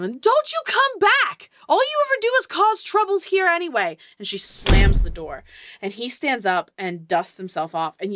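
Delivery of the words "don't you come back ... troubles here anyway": high, loud furious yell; screaming proprietor. Acted. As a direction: yell it out, high and fast at the end. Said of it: "scream out at him".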